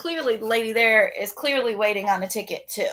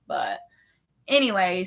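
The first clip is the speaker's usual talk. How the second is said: normal talking voice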